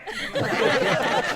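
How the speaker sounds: breathy high burst of laughter; real laugh at the story. Real, not acted. Teller laughs.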